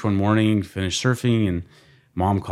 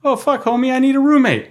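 high, loud, thrilled homie; buddy on the phone offering a room, high and excited; 6 dB louder than usual. Retold or imitated. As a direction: squeal it like a stoked roommate. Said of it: "He goes".

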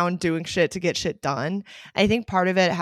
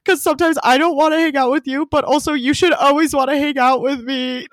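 much higher, louder tearful wail; crying harder; 8 semitones higher, 5 dB louder than usual. Retold or imitated. Explaining to Chris while sobbing, high tearful wail. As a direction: plead it through sobs. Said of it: "then I start crying, uh, harder".

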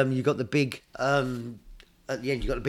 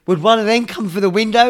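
much higher, louder mock retort; imitating Indy; 9 semitones higher, 10 dB louder than usual. Indy scoffing at his dad, high loud mock voice. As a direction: fire it back high and loud. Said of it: "He said".

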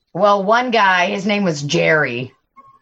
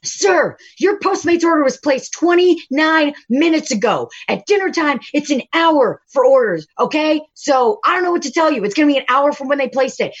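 higher, emphatic customer-service scolding; exasperated explaining; 6 semitones higher than usual. Retold or imitated. Server retelling explaining the wait to a Postmates driver, exasperated and emphatic. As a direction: spell it out for him, fed up. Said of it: "I'm like".

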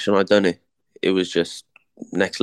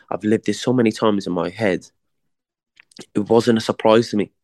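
normal talking voice

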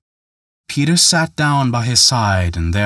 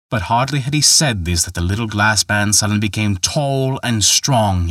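plain reading voice

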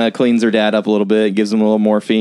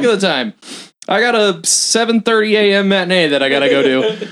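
high, mock-innocent excuse-making; awkward excuse; 9 semitones higher than usual. Projectionist claims a morning matinee, awkward excuse. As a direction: play up the flimsy excuse in a raised voice.

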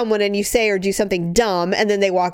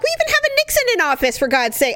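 exasperated outburst; 13 semitones higher, faster than usual. Retold or imitated. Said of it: "and I'm like".

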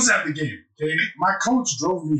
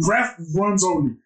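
normal talking voice

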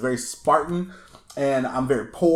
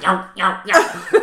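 calling out; 5 semitones higher, 5 dB louder, slower than usual. Retold or imitated. Riders calling out passing by.

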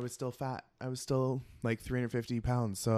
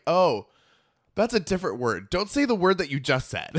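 urgent scolding; 6 semitones higher, 11 dB louder than usual. Retold or imitated. Sister correcting her urgently.

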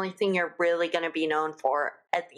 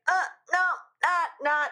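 mock alarm; 10 semitones higher than usual. Reacting to Goop team-up.

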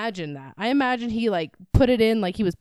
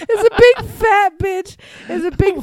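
laughing; 9 semitones higher, 7 dB louder than usual. Real, not acted. Laughing through the description.

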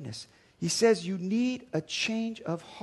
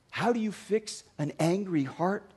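ordinary delivery

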